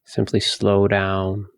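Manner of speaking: slow, spacious meditation voice; guided meditation. Acted. Teacher guiding listeners to empty their thoughts, guided meditation. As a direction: lead them gently inward, with long pauses.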